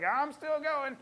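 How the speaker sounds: high-pitched voice of a plucky patient; a touch of chirpy, imitated perkiness; cheerful defiance. Vet voices a dog outliving its prognosis, perky and high.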